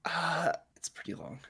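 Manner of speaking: soft spoiler whisper. Real, not acted. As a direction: whisper it like a secret. Hushed spoiler hint.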